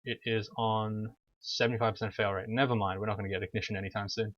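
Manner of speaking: usual speaking voice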